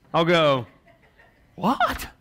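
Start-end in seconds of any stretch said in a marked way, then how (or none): 1.5-2.1 shocked what. Retold or imitated. Shocked at eight grand price.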